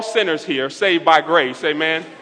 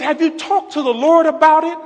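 high-pitched insistent motherly question; raised, urgent repeat; emphatic retold question; 11 semitones higher, 4 dB louder than usual. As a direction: imitate Mom pressing, pitched way up.

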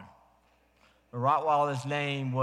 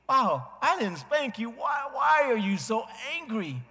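higher, louder baffled outburst; puzzled surprise; 10 semitones higher, 4 dB louder than usual. Retold or imitated. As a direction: wonder aloud why he is so angry. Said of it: "I'm thinking".

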